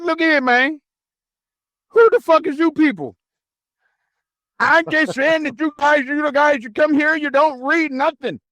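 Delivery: high, heated mock comeback; retold confrontation. Narrator replays telling off the guard, high and heated. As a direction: square up to him, half-acted.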